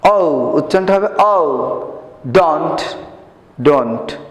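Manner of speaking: ডন্ট with a round open o, lips rounded; slow teacher demo of the rounded o in ডন্ট; rounded o sound. Shown as a demonstration. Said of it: "মুখটা গোল হবে".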